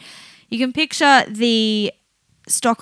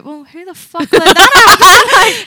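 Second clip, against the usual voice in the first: loud, high, drawn-out comic outrage; mock outrage; 9 semitones higher, 13 dB louder, slower than usual. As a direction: demand it like an appalled co-worker. Imagined workers react to embarrassment.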